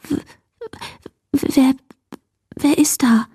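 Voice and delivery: stammering whisper with chattering teeth; frightened whisper. Acted. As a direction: whisper it into the dark, terrified. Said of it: "flüsterte sie schließlich mit klappernden Zähnen". (ängstliches Flüstern mit klappernden Zähnen)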